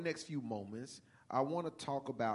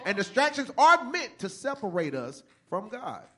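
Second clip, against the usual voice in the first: loud, rising sermon delivery; full-force preaching; 7 semitones higher, 10 dB louder than usual. Acted. Pastor warning the church about distractions, preaching loudly. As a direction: preach it hard to the congregation.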